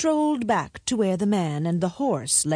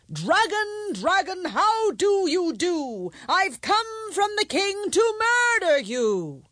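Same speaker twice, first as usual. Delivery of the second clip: loud and firm; 13 semitones higher, slower than usual. A narrator's reading. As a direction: chant it high and slow. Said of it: "Say it very loudly and firmly".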